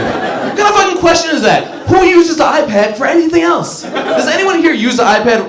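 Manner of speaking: high, loud incredulous rant; mock outrage. Real, not acted. Comedian to audience about the iPad question, high loud outrage. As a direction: shout it up high like you can't believe it.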